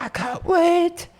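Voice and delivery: very high, slow squeal; giddy drunk Josh. Retold or imitated. Friend mocks drunk Josh eager for the bar, high squeal.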